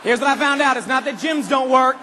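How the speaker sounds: ordinary talk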